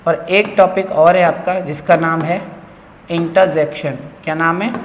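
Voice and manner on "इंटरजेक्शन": इंटरजेक्शन as "inta-jekshan"; correct pronunciation. Shown as a demonstration. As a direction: say इंटरजेक्शन with a silent R. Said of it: "मैं आर sound नहीं कर रहा हूँ". (इंटरजेक्शन बिना र के)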